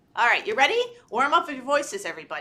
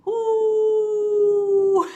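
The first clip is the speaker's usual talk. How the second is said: loud high hoot; owl hoot; 10 semitones higher, 9 dB louder than usual. Shown as a demonstration. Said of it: "what does she say".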